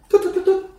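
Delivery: high, tutting bird call; bird chirp. Acted. Game master voicing a small bird flitting past, high chirps. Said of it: "and it goes".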